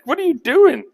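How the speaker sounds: high, loud mock scolding; exasperated brother. Brother scolding David for turning up, hammed-up exasperation. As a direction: berate him in playful disbelief.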